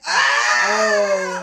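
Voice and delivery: loud, high shocked yell; real shocked holler; shocked shout. Real, not acted. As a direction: cry out at the burn. Reacting to savage comeback.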